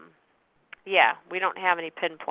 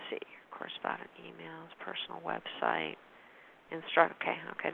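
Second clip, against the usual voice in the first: whispering the script to himself; reading under his breath mid-call; whispered aside; 4 semitones lower, 16 dB quieter than usual. Real, not acted.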